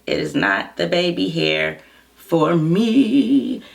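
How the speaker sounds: slow, deliberate catchphrase; slow drawn-out sass. Pretend. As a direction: stretch every word out with attitude. Slowed catchphrase take.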